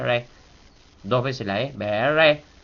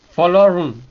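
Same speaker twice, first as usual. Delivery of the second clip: "Fo, lo, run" high and loud, falling on a nasal "run"; forceful high stress on "Fo, lo, run"; accented nasal vowel; 7 semitones higher, 9 dB louder than usual. Shown as a demonstration. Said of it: "vocal nasal acentuada".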